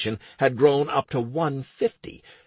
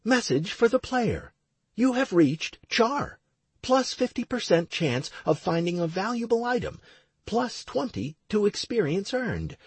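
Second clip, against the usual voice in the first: higher-pitched system notification voice; game system announcer; 9 semitones higher than usual. A narrator's reading. Game message to the player, announced. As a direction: announce the stats like a game alert.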